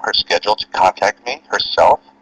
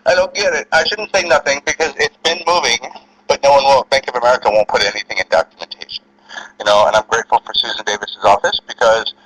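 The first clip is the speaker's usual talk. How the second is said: ordinary talk, nothing marked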